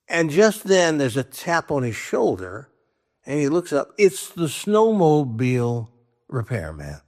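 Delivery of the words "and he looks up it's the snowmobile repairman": normal talking voice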